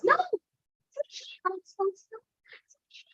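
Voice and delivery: high, then fading to a whisper; a small inner voice holding him back; conscience voice. Acted. His conscience warns him softly, high then whispered. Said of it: "My conscience says".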